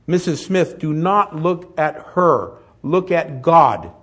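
loud, high, slow proclamation; pastor's command. Retold or imitated. Pastor telling the hysterical mother where to look, loud and slow. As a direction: fix her on God, firmly and slowly. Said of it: "the pastor comes out and he says".

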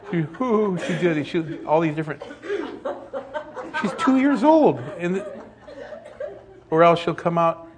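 talking through real laughter, high; words bubbling out between laughs; laughing. Real, not acted. Laughing while telling crying story.

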